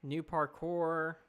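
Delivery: slow, loud "New parkour" read out; drawn-out reading. Shown as a demonstration. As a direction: read the chat request slowly and loudly. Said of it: "New parkour is a good one".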